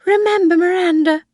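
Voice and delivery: put-on high, loud, fretful old woman pleading with her son; Missus Harvey, frightened old mother. Old mother repeats warning.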